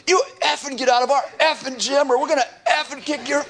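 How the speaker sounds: high, hammed-up angry yell, half laughing; mock tough-kid threat. Kid yelling at the Jehovah's Witnesses, mock furious and laughing. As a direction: play the hoodlum kid snarling them out of the gym.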